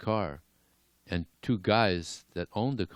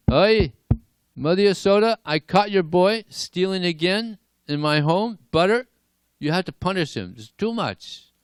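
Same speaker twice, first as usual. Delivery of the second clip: high, loud calling out at the door; shouting complaint; 12 semitones higher, 8 dB louder than usual. Acted. Neighbor lady shouting to Mother Yasoda about stolen butter, high and loud. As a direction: yell for the mother to come and punish him.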